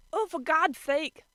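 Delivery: loud, slow exasperated outburst; exasperated snap. A narrator's reading. Kyo fed up with the dithering, loud exasperated. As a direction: lose patience. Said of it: "Kyo spat".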